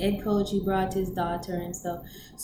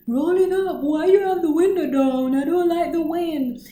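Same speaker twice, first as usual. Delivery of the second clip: higher, louder mock grumble; whiny complaint; 9 semitones higher, 6 dB louder than usual. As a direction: complain about the window. Teammate complaining in car.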